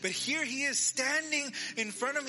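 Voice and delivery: acted wonder at someone back from the dead; amazed disbelief. Acting Mary amazed Jesus is alive.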